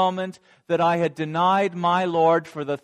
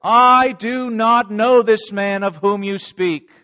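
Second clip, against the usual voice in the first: loud furious denial, pitched up; angry thunder; 4 semitones higher, 7 dB louder than usual. Acted. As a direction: thunder it out in anger. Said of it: "in anger I thundered".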